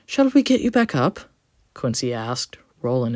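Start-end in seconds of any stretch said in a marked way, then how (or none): 0.1-1.2 high, kind helpful voice; gentle engine voice. A narrator's reading. Quincy gentle to coach.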